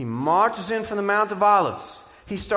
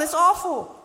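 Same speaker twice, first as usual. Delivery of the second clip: "awful" even higher, a squeal of disgust; mock horror; 11 semitones higher, 5 dB louder than usual. Preacher topping the priests' outrage, very high. Priests horrified at temple scene.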